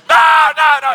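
very high, loud wailing no; crying kid refusing. Retold or imitated. Father voicing his son refusing the ride, high wailing. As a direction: wail like a panicked child.

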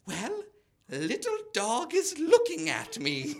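put-on high, slow old lady voice; grandma voice. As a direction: dote on the little dog. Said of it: "She'd be like".

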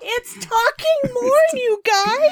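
very high, loud, drawn-out squeal; gleeful outburst. Real, not acted. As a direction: shriek it slowly, tickled by the idea. Laughing outburst at the idea.

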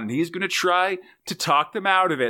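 ordinary talk

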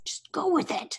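hushed aside whisper; breathy whisper to a friend. Real, not acted. Aside to friend during scene.

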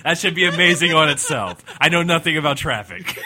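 loud, hammed-up helicopter traffic report; radio traffic reporter. Gorilla traffic reporter continues.

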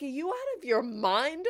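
high, loud, incredulous comeback; retold outraged disbelief; indignant retort. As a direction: fire back as if in a marital spat. Retold quarrel with husband.

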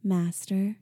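mild sarcastic "Master" with a rise. A narrator's reading. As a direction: say it with a light mocking lilt. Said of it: "I added sarcastically".

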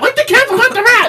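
movie quote shout. Retold or imitated. Quoting the line again, higher.

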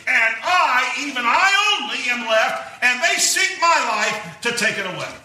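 a little attitude. Retold or imitated. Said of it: "he may have said this with a little attitude".

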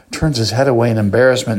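ordinary talk, nothing marked

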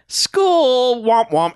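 high, loud sing-song womp womp; mocking kids voice. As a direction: whine it like bored kids.

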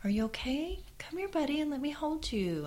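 put-on high, gentle coaxing to a toddler; mother calling her hurt toddler, high and soothing; soothing mommy voice. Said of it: "I'm like".

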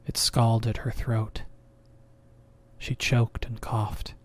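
plain reading voice

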